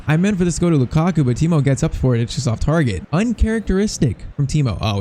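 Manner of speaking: usual speaking voice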